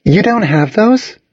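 high-pitched incredulous question; disbelieving. A narrator's reading. New girl learns they have no class trips, disbelieving.

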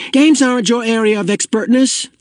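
high-pitched indignant put-down; cross child claiming his turf; angry. A narrator's reading. Boy telling friend games are his field, angrily. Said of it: "Asher pointed out angrily".

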